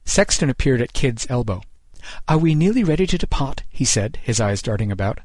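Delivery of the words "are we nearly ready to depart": higher-pitched, fretful question; lightly voiced anxious scholar. A narrator's reading. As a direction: ask it high, eager to be off. Anxious Sexton asks, eyes darting.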